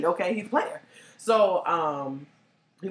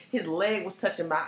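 normal talking voice

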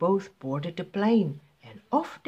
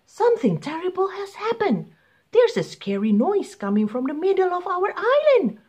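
high, pleading pilot voice, quick on the request. Acted. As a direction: beg the beast for help. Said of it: "said the pilot".

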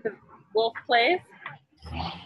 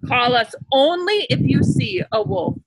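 higher, stern telling-off; scolding villager; 7 semitones higher than usual. A narrator's reading. Villager scolding the boy for lying, stern and high. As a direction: wag a finger at the boy. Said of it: "scolded another".

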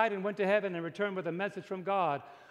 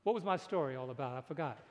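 dropped, much softer voice; low aside; 6 semitones lower, 5 dB quieter than usual. Real, not acted. Preacher admits losing his story, low aside. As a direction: drop out of preaching into a quiet, low admission.

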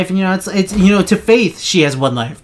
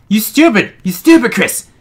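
angry yell; 6 semitones higher than usual. Real, not acted. Yelling at game character.

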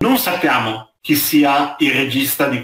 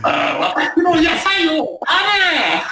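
high, slow, shrill crone yell in Japanese; 野菜を守るおばあさんの叫び; old woman shouting; much slower than usual. Retold or imitated. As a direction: bellow at the kids to leave her vegetables. Same old woman line in Japanese.

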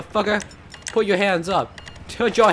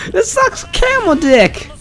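mock wail; 10 semitones higher, slower than usual. Wailing in mock despair.

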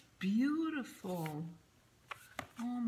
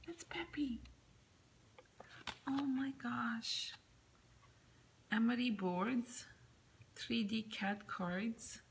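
whispering; 3 semitones higher than usual. Real, not acted. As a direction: whisper each find in wonder. Hushed unboxing.

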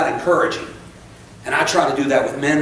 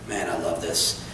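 laughing softly; 11 semitones lower, 10 dB quieter than usual. Real, not acted. Laughs at passage.

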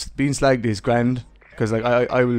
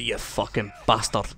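put-on angry Scottish accent. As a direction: put on an angry Scot making threats. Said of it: "I can put on an okay Scottish accent".

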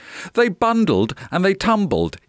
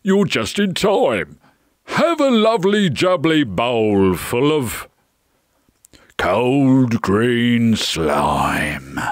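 relishing giant voice, slowing right down at the end; grinning giant; 3 semitones lower, slower than usual. A narrator's reading. Giant offers his guests slime, slow relishing voice. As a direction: draw out the menu like a giant. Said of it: "grinned the giant".

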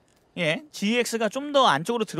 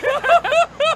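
high laugh; 5 dB louder than usual. Real, not acted. Laugh during playful bit.

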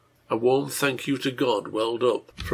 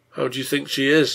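plain reading voice

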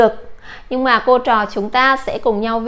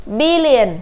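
billion with BIL stressed and a falling end; stress on first syllable; 2 semitones higher than usual. Shown as a demonstration. Teacher drilling billion with BIL stressed. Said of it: "Chúng ta cũng nhấn âm đầu".

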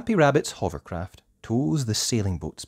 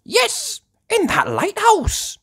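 very high, loud little voice; Danny; 7 dB louder than usual. Acted. Danny answers Peppa, high piping voice. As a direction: pipe up with the answer. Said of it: "says Danny".

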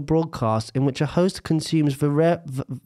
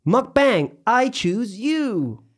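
Pokemon trainer call; 7 semitones higher, much slower than usual. Acted. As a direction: shout it like summoning a Pokemon. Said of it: "as in".

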